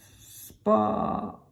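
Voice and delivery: unvoiced spit sound; spitting. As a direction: spit the sand out. Neil spitting out sand.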